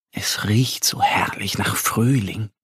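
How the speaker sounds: whisper. A narrator's reading. Savouring the spring smell.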